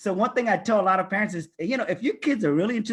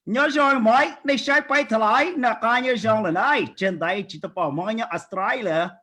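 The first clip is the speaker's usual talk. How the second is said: put-on higher, louder Hmong Australian accent; 4 semitones higher, 4 dB louder than usual. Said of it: "That's me doing my Hmong Australian accent".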